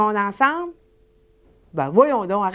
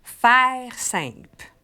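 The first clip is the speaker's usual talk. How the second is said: slow demo; much slower than usual. Shown as a demonstration. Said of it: "Ben là, on commence".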